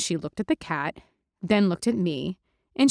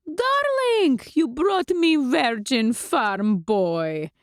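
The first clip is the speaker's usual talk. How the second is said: high darling, then slow and drawn out; vampire Lulu, accented; 6 semitones higher, much slower than usual. Acted. Lulu slowly greets Mandy from the couch, accented vampire voice. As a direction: purr like an old-world vampire countess.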